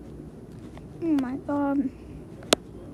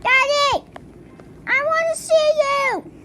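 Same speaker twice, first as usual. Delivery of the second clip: calling out; 7 semitones higher, 4 dB louder than usual. Acted. Child calling for dad in game.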